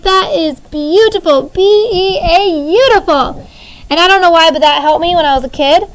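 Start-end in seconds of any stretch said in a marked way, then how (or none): 0.0-3.5 lightly sung B E A, then beautiful; funny spelling trick. Retold or imitated. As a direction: sing out the letters B E A playfully, then say beautiful. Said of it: "I'm like".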